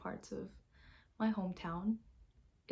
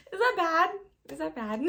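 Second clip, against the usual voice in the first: high, loud question through laughter; laughing at your own bluntness; 10 semitones higher, 14 dB louder than usual. Real, not acted. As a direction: ask it mid-giggle.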